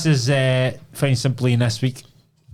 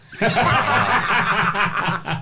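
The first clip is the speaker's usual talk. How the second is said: high burst of laughter; 11 semitones higher than usual. Real, not acted. Laughing at answer.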